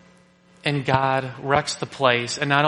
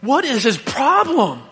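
high, slow, incredulous cry of disbelief; mock exasperated yell; exasperated outburst; 8 semitones higher, slower than usual. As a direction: throw up your hands at him. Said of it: "I'm, like, driving down the road going".

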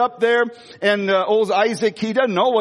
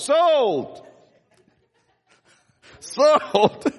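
higher, joyful cry of sold; jubilant shout; 7 semitones higher than usual. Real, not acted. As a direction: exclaim it high and glad.

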